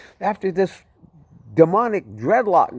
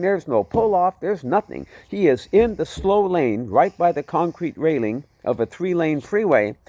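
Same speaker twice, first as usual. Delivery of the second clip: plain reading voice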